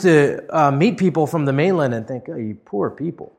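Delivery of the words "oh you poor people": ordinary talk, nothing marked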